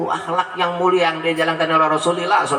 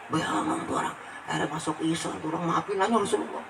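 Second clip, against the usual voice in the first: soft laughing aside; 3 semitones lower, 10 dB quieter than usual. Real, not acted. Laughing softly at story.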